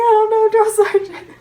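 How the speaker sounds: high, loud mock wail; imitated tearful panic. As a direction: cry it out like a flustered recruit. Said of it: "I'm, like, hyperventilating".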